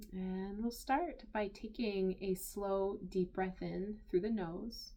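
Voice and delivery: soft, unhurried meditation voice with long pauses; guided meditation. Acted. Teacher inviting listeners to settle into the body, guided meditation. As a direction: guide them gently into stillness, leaving space between phrases.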